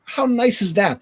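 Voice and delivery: mock disbelief. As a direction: replay the shock of being remembered.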